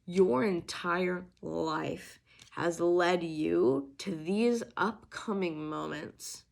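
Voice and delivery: slow, drawn-out "your entire life has led you"; emphatic stress. Real, not acted. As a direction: weigh out "your entire life" slowly, word by word. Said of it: "Can't stress it enough".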